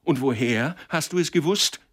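loud, high, angry demand; forceful accusing shout; thundering. A narrator's reading. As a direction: thunder the question at the culprit. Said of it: "donnerte Türkisch Gelb".

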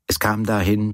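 plain reading voice

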